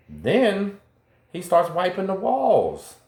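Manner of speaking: high, drawn-out incredulous narration; dramatic build-up. Retold or imitated. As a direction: tell the punchline detail with disbelief. Building up the odd night scene.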